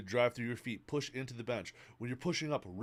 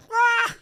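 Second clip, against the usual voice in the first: sudden high yell; short wild shout; 11 dB louder than usual. Real, not acted. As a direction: let out a sharp high yell. Yell ending the rant.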